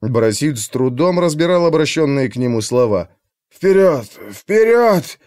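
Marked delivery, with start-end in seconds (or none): 3.5-5.2 hoarse wheeze. A narrator's reading. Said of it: "хрипел он".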